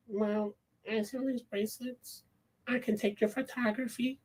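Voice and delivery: shy sales pitch in a small voice; awkward girl. Acted. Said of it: "these characters are so fucking awkward".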